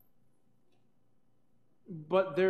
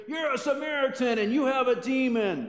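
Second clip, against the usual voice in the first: higher, pointed accusation, shouted; accusing shout; 8 semitones higher than usual. Retold or imitated. Pharisees on their feet accusing Jesus, mock angry shout. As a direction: jab the words like a pointing finger. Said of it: "they stand up and they start pointing their fingers".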